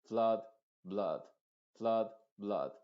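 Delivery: FLOOD and BLOOD with a short 'uh'; barely open mouth. Shown as a demonstration. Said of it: "como que no quisieses abrir la boca".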